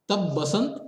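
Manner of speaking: usual speaking voice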